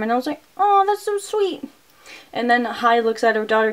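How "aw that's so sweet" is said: a high, slow, cooing aww. Real, not acted. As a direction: melt at the sweet moment, high and slow. Reacting to a touching moment.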